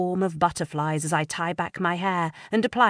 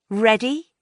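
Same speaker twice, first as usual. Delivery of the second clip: teasing question; 6 semitones higher, 5 dB louder than usual. Acted. Lou asking.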